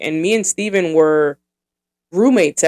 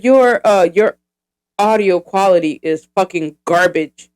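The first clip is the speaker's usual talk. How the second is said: Kevin Hart-style Francisco; 3 semitones higher, 5 dB louder than usual. Retold or imitated. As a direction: rib her like a stand-up heckler. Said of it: "he talks like Kevin Hart mixed with, uh, Cat Williams".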